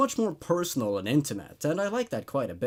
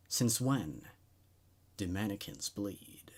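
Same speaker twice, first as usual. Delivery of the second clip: hushed dread; 6 semitones lower, 9 dB quieter than usual. A narrator's reading. Horrified realization at bloodstain.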